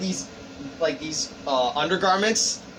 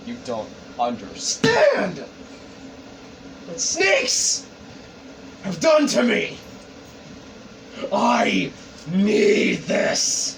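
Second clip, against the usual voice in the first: acted anger, drawn out for drama; angry little outburst; slower than usual. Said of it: "he gets a little mad".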